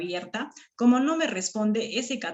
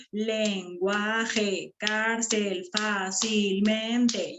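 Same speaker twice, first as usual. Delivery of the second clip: lenguaje, cárcel, fácilmente split into drawn-out syllables; much slower than usual. Shown as a demonstration. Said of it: "esos son sílabas".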